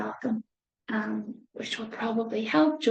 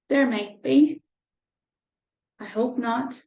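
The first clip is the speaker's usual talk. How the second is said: plain reading voice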